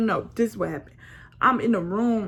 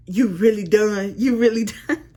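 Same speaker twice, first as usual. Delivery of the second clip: laughing while quoting; 3 semitones higher, 7 dB louder than usual. Real, not acted. She reads her fiancé's text aloud, laughing loudly in disbelief. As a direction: read his text, cracking up.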